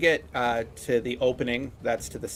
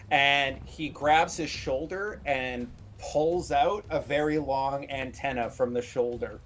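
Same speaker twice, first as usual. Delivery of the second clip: ordinary talk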